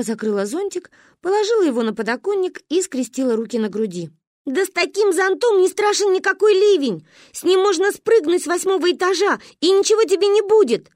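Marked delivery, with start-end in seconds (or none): 4.5-10.9 put-on high, loud little girl's brag; fervent boasting. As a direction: insist loudly like a boastful kid. Child boasts in an argument. (хвастливо, громко и высоко)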